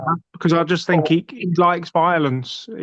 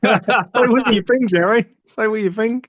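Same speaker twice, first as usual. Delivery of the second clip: put-on Northern Irish accent; 4 semitones higher than usual. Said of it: "I'm trying to do a Northern Irish accent".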